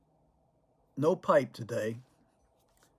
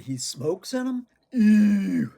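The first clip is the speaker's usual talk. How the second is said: loud, high mock "Ew" of disgust; grandkids' disgust; 8 semitones higher, 11 dB louder, slower than usual. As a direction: shriek out a kid's disgust.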